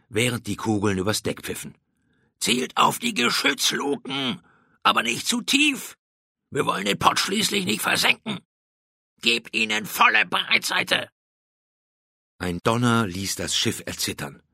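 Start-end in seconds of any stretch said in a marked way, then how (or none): 2.4-11.1 high, called-out commands to the gunners; shouted battle orders. A narrator's reading. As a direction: bark orders over the cannon fire.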